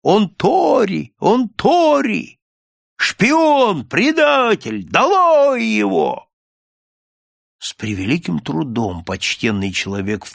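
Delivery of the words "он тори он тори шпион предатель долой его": high, drawn-out indignant cries; crowd outcry. A narrator's reading. Onlookers denouncing the stranger as a spy, high outcry. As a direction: cry it out in alarm, stretching each word. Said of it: "вырвался общий крик".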